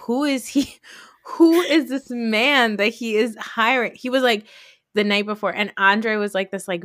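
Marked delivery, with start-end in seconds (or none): 0.0-3.9 rising, drawn-out suspicious question; wary disbelief about the hired driver, higher and slower. Retold or imitated. Talking about the concierge's driver, suspicious rising question.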